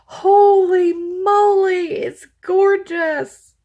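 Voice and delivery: high, loud, drawn-out amazement; excited gushing. Real, not acted. Crafter unboxing a gift she finds gorgeous, excited gushing. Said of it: "this is what I got excited about".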